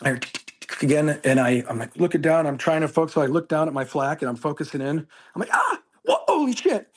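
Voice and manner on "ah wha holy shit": high, sudden shocked cry; a yelp of fright breaking high; startled yelp. Retold or imitated. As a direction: jump at the sight of a spider. Startled by spider on flak.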